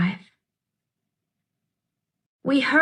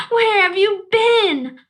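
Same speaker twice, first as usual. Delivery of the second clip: much higher, slow, overjoyed; relieved question; 10 semitones higher, 4 dB louder than usual. Acted. Girl hugging her lost dog, joyful and high. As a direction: ask it hugging him tight, full of relief.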